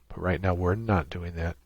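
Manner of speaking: normal talking voice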